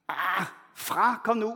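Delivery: normal talking voice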